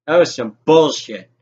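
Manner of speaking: normal talking voice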